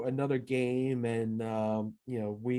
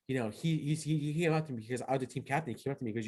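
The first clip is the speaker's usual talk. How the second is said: ordinary delivery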